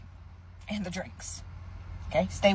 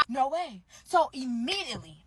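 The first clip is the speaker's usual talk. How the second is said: usual speaking voice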